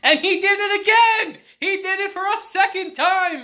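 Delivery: loud, high, laughing exclamation; amused outburst. Real, not acted. Telling viewers he did it again, amused shouting. Reacting, laughing, to the repeat blunder.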